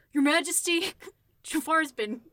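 loud, high bro voice breaking into laughter; bro-ish impression. Speaker mimics partner's cartoon impression, bro-ish and laughing. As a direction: imitate a bro-ish hero, cracking up partway. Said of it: "like, bro-ish. It's very bro-ish".

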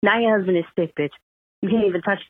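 ordinary talk

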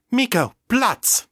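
sharp, high-pitched command; dog command. A narrator's reading. Lisa ordering Miko down, sharp high command.

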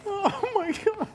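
high, wheezy laughing words; too tickled to talk properly; helpless laughter. Real, not acted. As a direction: give up through giggles. Co-host helpless after the bad accents.

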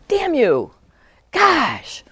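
loud, high burst of exasperation; frustrated outburst. Shown as a demonstration. Venting at the absent friend, a loud frustrated outburst. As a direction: let the frustration blurt out at them. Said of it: "on your emotional level, you're like".

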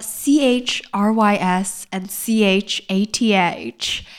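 slow letter-by-letter spelling; spelled out slowly. Shown as a demonstration. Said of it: "that's spelled".

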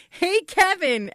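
high, drawn-out mock call; retold yell. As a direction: call out a greeting, imitating the husband. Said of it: "Scott yells out".

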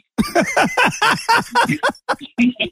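a high falling cackle at his own joke; high burst of laughter. Real, not acted. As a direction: laugh out high and let it fall.

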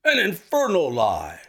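loud, high furious denial; angry shout. A narrator's reading. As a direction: hurl the denial at him. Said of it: "he shouted".

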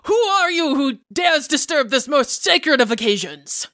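high, theatrical challenge; hammed-up swashbuckler; pompous indignation. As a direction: demand it like an affronted duelist. Challenged duelist answers with grand indignation.

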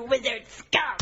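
loud warrior challenge; bold young warrior. Pretend. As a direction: call out the foe like a hero. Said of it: "warrior voice".

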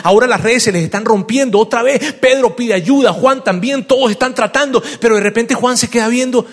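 high, loud, breathless-paced storytelling; excited preaching. Acted. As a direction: build the frantic scene with rising urgency. Animated preaching of a dramatic scene. (prédica emocionada, aguda y enérgica)

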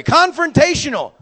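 ordinary talk, nothing marked

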